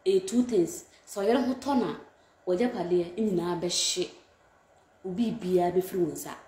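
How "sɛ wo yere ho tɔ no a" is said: ordinary talk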